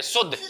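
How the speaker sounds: शुद्ध said with a brief u; don't stretch the u in शुद्ध; short u. Shown as a demonstration. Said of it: "छोटे ऊ की मात्रा को छोटा बोलते हैं".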